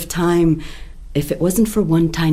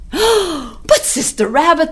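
higher, drawn-out pleading; animals' cry; 11 semitones higher, slower than usual. Acted. Animals cry to the rabbit not to go. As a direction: plead with her. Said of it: "they cried".

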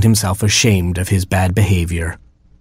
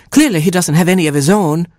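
higher mother hen voice; a fussy mother hen, pitched up; Mama Hen voice; 10 semitones higher, faster than usual. Acted. Mother hen finding her oatmeal eaten, higher voice.

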